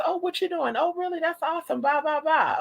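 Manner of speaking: high, sugary small-talk voice; exaggerated high sweet chatter; overly nice neighbor. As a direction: gush with fake-friendly interest. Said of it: "Daddy would be so nice".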